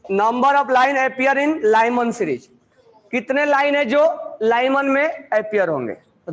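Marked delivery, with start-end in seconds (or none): 0.1-2.5 words spaced out slowly for students to write; dictating a question to the class; slow dictation. Real, not acted.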